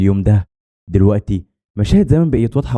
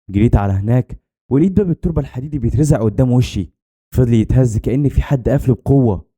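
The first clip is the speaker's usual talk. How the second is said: plain reading voice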